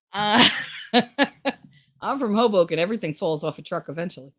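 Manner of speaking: normal talking voice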